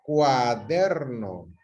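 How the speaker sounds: "Cuaderno" drawn out very slowly, syllable by syllable; syllables stretched out. Shown as a demonstration. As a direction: stretch each syllable of "Cuaderno", falling at the end. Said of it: "yo vamos a dividir".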